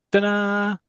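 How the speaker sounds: high, loud ta-da flourish; sung-out ta-da fanfare; triumphant fanfare. Acted. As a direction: announce a big reveal in one held high note. Sudden reveal in the comic.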